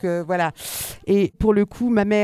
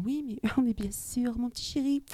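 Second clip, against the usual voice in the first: gentle, sweet mother; 5 semitones higher, 7 dB quieter than usual. Retold or imitated. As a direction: imitate a mother fawning over her darling boy. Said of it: "si elle répondait gentiment en disant". (voix de maman toute gentille et mielleuse)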